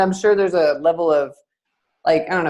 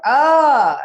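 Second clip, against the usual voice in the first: high, loud, drawn-out mock cry of frustration; exasperated outburst; 9 semitones higher, 7 dB louder than usual. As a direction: let out an imitated parent's frustrated "ah". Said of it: "being angry with the child".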